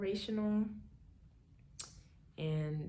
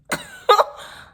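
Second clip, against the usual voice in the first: a high, loud shriek of a laugh; shrieking disbelieving laugh; 15 dB louder than usual. Real, not acted. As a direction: burst out in a squeal of disbelief. Stunned reaction to father's support.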